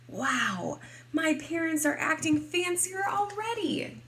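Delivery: high, climbing excited marvel; delighted amazement. Acted. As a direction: marvel like an impressed little girl. Child narrator marvels at parents.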